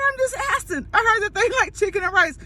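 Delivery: high-pitched defensive sister; innocent protest. Retold or imitated.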